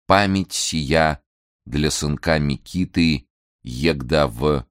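slow halting deciphering; slow reading. Acted. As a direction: spell it out word by word. Slowly deciphering old script.

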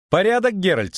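high-pitched, brisk companion; Lyutik. A narrator's reading. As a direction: report it in a high voice. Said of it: "сказал Лютик".

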